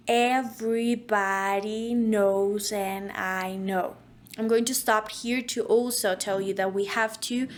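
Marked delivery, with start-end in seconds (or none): 0.1-3.9 slow drill. Shown as a demonstration. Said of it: "start slow".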